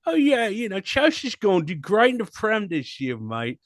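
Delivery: put-on high, cheery English football fan accent; hammed-up English accent; mock English accent. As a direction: play a cocky English Chelsea fan. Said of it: "in that accent now".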